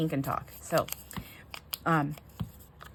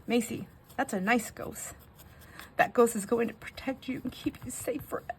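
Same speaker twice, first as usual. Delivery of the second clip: higher, hushed and careful comforting; reassuring mom; 6 semitones higher than usual. Retold or imitated. Mom reassuring toddler about the ghost, gently imitated comfort. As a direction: soothe a frightened little one. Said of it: "And she goes".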